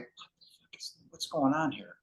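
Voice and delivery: retold thought, starting nearly inaudible; bewildered undertone. Man sensing that same feeling, bewildered undertone. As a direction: start under the breath, then softly voiced. Inner reaction to strange feeling.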